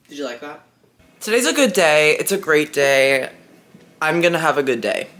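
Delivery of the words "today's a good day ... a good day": loud self-affirmation; loud mantra of a good day; loud pep talk. Real, not acted. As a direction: shout encouragement to yourself. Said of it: "self pep talk".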